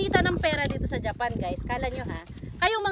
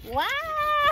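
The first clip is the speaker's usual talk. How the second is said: excited cheer; 12 semitones higher, 7 dB louder than usual. Real, not acted. Cheering after sawing through.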